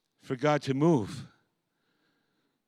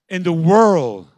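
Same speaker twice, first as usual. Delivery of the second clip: louder, slower, rising to a cry; swelling preacher's cry; 3 semitones higher, 12 dB louder, slower than usual. Real, not acted. Preacher lifting the congregation to a loud peak. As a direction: let the word ring out big.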